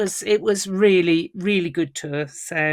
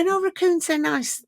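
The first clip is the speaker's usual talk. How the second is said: mocking people; 11 semitones higher than usual. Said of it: "people go".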